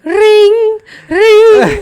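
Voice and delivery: high ringing sound through laughter; laughing mock phone ring; laughing ring imitation.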